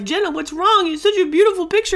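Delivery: high-pitched imitation of a puzzled dad; high, insistent retold reassurance; concerned dad. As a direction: act out a dad protesting in disbelief. Imitates dad puzzled by her tears.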